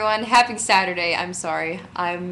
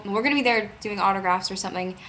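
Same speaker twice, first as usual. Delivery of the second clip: normal talking voice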